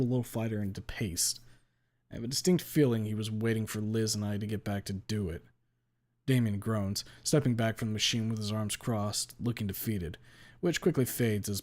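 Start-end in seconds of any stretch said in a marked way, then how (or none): none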